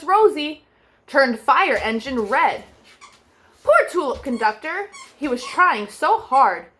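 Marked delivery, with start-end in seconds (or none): none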